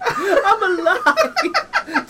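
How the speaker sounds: ecstatic shout. Pretend. Mock castaway joy shout.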